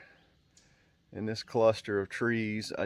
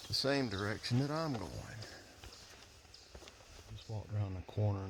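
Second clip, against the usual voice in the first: hushed; 8 dB quieter than usual. Real, not acted. Staying quiet near the bear.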